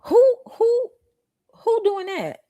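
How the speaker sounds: high, loud disbelieving who; incredulous. Real, not acted. Disbelief at girl's claim.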